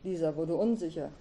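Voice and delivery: plain reading voice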